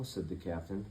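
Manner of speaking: usual speaking voice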